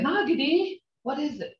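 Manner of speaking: lightly voiced curious question at something; asking curiously. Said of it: "I'll say".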